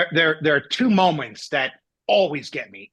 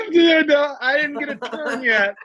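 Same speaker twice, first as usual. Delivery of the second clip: high-pitched, hammed-up whine; mock wailing protest; 11 semitones higher than usual. Man protesting he missed his turn, mock wail.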